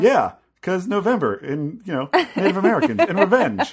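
hammed-up cheerful repeat; imitating eager friend. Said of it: "like, reiterated".

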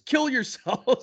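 "Kill yourselves" high and slow, drawn out like a hidden backwards message; mock sinister. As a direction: stretch "Kill yourselves" slowly, up high, half joking. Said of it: "There, I said it".

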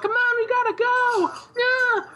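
very high-pitched frantic urging; mock urgent yell. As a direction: imitate a panicked bystander calling out, high. Acting bystander yelling to flee.

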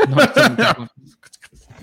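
laughing through the words, high-pitched; giggling it out, slow. Real, not acted. Echoing partner while laughing.

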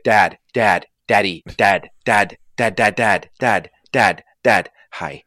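loud, rapid-fire mock nagging for attention; pestering talking dog. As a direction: pester dad like a needy dog, each word punched. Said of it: "if he could talk he'd just be like".

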